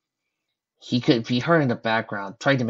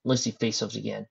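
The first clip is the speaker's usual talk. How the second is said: usual speaking voice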